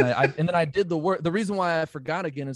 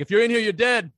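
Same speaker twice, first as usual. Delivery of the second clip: mock threat; 8 semitones higher, 6 dB louder than usual. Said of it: "I always talk shit to people".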